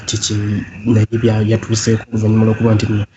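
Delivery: ordinary talk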